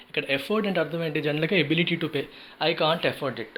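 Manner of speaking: normal talking voice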